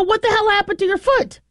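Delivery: alarmed cry. Retold or imitated. Owner finding her dog's bloody foot, high alarmed cry. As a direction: shriek the question in alarm. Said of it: "I go".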